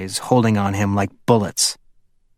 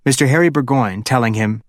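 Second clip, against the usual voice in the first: usual speaking voice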